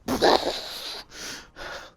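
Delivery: airy unvoiced laugh; short breathy chuckle. Real, not acted. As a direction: laugh at the idea. Reacting to friend's claim.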